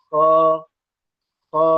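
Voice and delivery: خا said with a thick, rasping throat sound; teacher's model خا, thick and rasped; thick kha demo. Shown as a demonstration. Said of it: "خا کی آواز موٹی ہوتی ہے".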